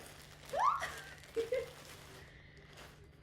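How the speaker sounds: a breathy, delighted shriek of laughter; high shrieking laugh. Real, not acted. Delighted after a lucky pull.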